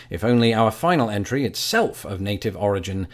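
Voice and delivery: ordinary talk, nothing marked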